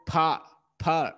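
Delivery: "pa" said alone as one syllable; isolated syllable. Shown as a demonstration. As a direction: sound out "pa" separately, with a gap. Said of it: "there is a one vowel sound in per".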